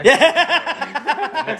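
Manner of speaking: high, loud yeah through laughter; laughing yeah. Real, not acted. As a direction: laugh the word out. Laughing at 'not really'.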